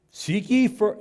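ye hit high and loud; stress on ye. Acted. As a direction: lean hard on ye. Preacher stresses scripture phrase.

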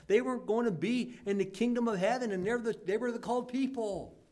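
high, loud preaching peak; fiery preaching. Acted. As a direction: drive the point home. Preaching climax.

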